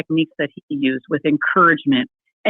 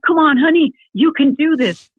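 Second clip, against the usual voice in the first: high, louder pep talk to a struggling husband; cheery encouragement; 9 semitones higher, 4 dB louder than usual. Retold or imitated. Wife cheering on her husband at boot camp, high and bright. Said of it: "I'm like".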